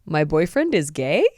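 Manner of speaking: title read as a puzzled question; questioning tune. Real, not acted. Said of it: "It's a question".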